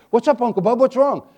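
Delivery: put-on a frightened boy running back; scared boy. Boy in the dark answers his uncle, mildly scared.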